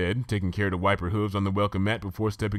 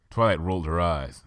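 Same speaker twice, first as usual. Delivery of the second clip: usual speaking voice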